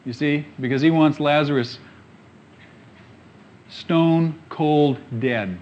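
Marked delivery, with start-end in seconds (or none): 3.7-5.6 "stone cold dead" drawn out very slowly, each word weighed; slow emphasis. Acted. Preacher stressing Lazarus is truly dead, very slow.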